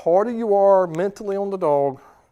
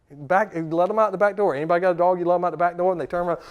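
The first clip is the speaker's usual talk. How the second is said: ordinary delivery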